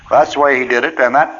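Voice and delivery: ordinary talk, nothing marked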